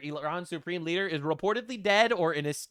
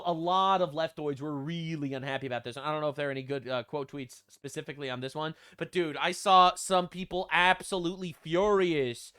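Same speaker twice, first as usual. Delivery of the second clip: normal talking voice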